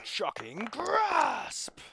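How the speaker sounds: high, slow, drawn-out spell shout; dramatic spell call. Acted. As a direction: boom it out like casting a big spell.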